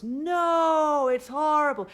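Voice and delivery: high, loud alarmed no; body protesting. Pretend. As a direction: shout a panicked no from the gut. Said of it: "And your body goes".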